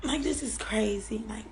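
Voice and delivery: drained, breathless whisper. Real, not acted. Mother stunned after crying, whispering. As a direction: let it out barely voiced.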